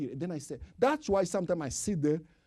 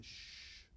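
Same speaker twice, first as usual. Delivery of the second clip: soft drawn-out shh; shushing; 21 dB quieter than usual. Shown as a demonstration. Preacher showing how to hush a noisy person. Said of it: "If someone is making noise, you say".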